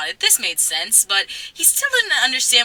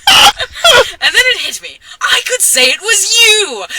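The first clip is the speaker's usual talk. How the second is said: high, loud laughing delivery; giggling while reading the line; laughing as she reads to her scene partner; laughing through the line; 11 semitones higher, 6 dB louder than usual. Real, not acted.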